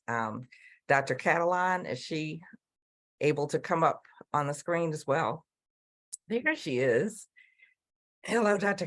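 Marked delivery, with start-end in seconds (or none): none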